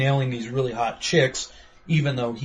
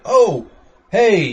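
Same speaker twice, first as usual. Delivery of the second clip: calling out; 6 semitones higher, 7 dB louder than usual. Retold or imitated. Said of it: "they're like".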